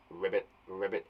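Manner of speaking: soft croaky ribbit, ribbit; frog croak imitation. Shown as a demonstration. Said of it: "it's that sound".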